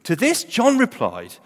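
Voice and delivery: ordinary talk, nothing marked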